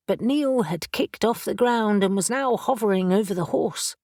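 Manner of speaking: ordinary delivery, nothing marked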